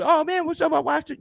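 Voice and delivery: mock nervous fanboy; nervous fan greeting. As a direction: play the starstruck fan in person. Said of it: "just on some".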